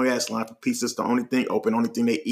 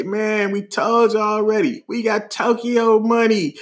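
high, slow bragging; drawn-out boast; 7 semitones higher, slower than usual. Retold or imitated. Friend bragging to strangers about money, slow high boast. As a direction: imitate a friend showing off, stretching the words.

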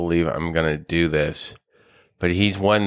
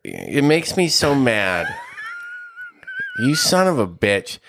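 mock anger; 10 semitones higher than usual. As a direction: ham up being mad at your buddy. Mock fury over friend's bet.

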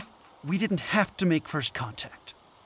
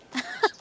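scoffing laugh. Acted. Scoffing during heated argument.

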